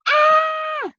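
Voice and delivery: high shrieking scream of fright; terrified scream. A narrator's reading. Teddy seeing the bone fly at them, screaming in terror. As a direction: scream in panic. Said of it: "yelled Teddy".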